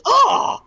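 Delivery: very high, loud groan of dismay; disappointed cry. Acted. Warrior finds the dragon already dead, disappointed cry. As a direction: let out a let-down wail. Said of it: "his arms sink to his side".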